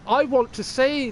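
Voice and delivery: high, drawn-out parody of an ad voice; bad radio advert person. Mocking the bus driver advert, bad radio-ad voice. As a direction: stretch it like a badly acted bus driver. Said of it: "some bad fucking radio advert person".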